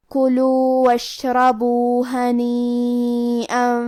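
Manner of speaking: slow, melodic tajweed chant; Quran recitation. Acted. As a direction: recite each word drawn out like in prayer.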